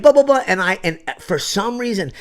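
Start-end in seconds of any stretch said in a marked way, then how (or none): 0.0-0.4 mock babble. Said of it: "someone was like".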